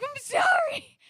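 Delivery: very high, loud plaintive cry; hammed-up high-pitched pleading; mock wailed apology. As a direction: wail it like a guilty, frazzled friend. Acts out wailed apology to friend.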